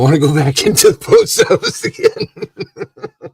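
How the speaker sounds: laughing through the line. Real, not acted. Telling friends about the post office, laughing. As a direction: chuckle while admitting it.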